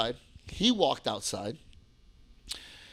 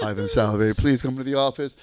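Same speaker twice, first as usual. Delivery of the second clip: low, loud office summons; school announcement; 2 semitones lower, 6 dB louder than usual. Retold or imitated. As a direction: imitate the school intercom announcer.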